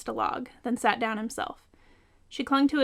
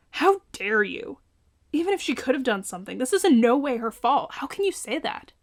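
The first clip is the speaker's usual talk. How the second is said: growled anger; 3 semitones higher, 5 dB louder than usual. A narrator's reading. Tony rounds on John for blaming Melly, furious. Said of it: "Tony growled".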